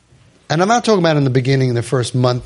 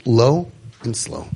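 slow, lower, calm delivery; low and slow demo; 4 semitones lower, slower than usual. Shown as a demonstration. Counselor showing a father how to talk slowly. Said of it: "you can't be so big".

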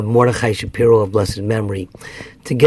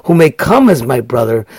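pleading; 6 semitones higher, 4 dB louder than usual. Retold or imitated. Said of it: "He's pleading".

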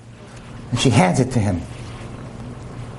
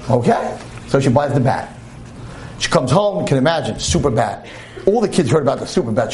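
normal talking voice

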